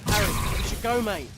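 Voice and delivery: loud, pressing call to leave; loud urgent call. Acted. Ron yelling at Harry to leave the wedding, urgent. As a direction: shout over the chaos. Fleeing the attack.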